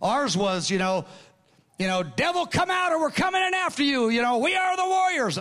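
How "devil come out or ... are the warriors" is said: mock battle shout. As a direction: shout it like a charging warrior, pitched way up. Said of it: "That's how we did it".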